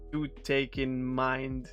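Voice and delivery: ordinary talk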